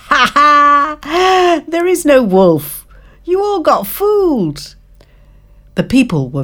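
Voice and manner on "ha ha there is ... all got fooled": put-on high, teasing boy's laugh; mocking laugh. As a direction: gloat over the fooled villagers. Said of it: "The boy laughed".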